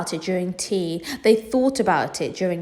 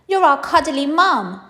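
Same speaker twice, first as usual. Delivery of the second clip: put-on higher, louder little girl; sweet daughter reassuring mum; child's voice; 8 semitones higher, 5 dB louder than usual. Laura voiced in story.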